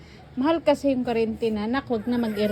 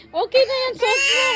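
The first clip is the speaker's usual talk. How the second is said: raised, higher coaxing voice; louder pleading; 9 semitones higher, 5 dB louder than usual. Real, not acted. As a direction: push over the crying, higher and louder. Pleading with crying child. (pakiusap nang malakas)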